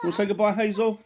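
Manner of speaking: high, sing-song coaxing. Real, not acted. Coaxing a baby.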